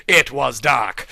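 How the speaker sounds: normal talking voice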